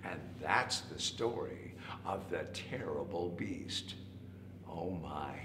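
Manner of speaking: slow, low, soft wrap-up; hushed story ending. A narrator's reading. Storyteller ending the tale for children, slow hushed voice. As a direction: close it softly, almost whispering.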